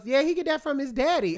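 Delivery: mom's comeback. Retold or imitated. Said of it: "she goes".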